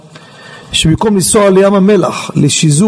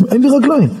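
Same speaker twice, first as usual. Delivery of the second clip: higher, retold cry of alarm; rising alarm; 5 semitones higher, faster than usual. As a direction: repeat it higher, as the alarm sinks in. Repeats it as alarm grows. (בבהלה גוברת)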